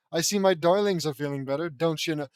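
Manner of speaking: put-on amateur Minnesota accent. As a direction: try a broad Minnesota mom. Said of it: "Minnesota accent?".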